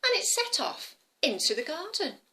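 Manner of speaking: ordinary delivery, nothing marked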